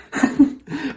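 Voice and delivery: short high chuckle; amused little laugh. Real, not acted. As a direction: let out a brief, high laugh. Laughs after promising to find out.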